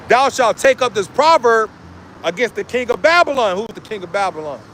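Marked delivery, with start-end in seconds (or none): 0.1-3.6 solemn preacher's reading; scripture reading. Acted. Reads scripture aloud.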